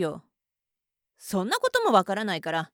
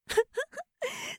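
a sudden high, tittering laugh of scorn; high mocking giggle; 13 semitones higher, 7 dB quieter than usual. Mistress laughing down at her masochist, high mocking giggle. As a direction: giggle at him like he's pathetic.

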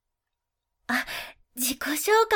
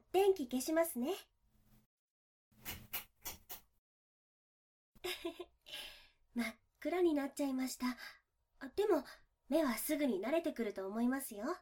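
much softer, hushed bedside voice; 12 dB quieter than usual. Acted. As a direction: drop to a hush in the dark. Lights off, lying close in dark.

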